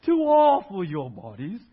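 slow, heavy, overly solemn scripture reading. Shown as a demonstration. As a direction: read it like a grave pronouncement. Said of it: "we hear it like that, and we get very serious".

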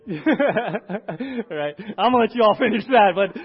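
loud laughing aside; a real laugh at his own poem joke. Real, not acted. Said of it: "laughing at the joke".

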